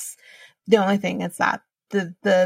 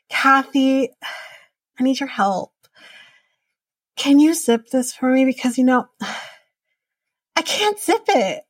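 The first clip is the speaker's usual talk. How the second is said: higher-pitched imitation of asking for help; mock helpless plea; 5 semitones higher than usual. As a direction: play up a coy, helpless request, pitch climbing at the end.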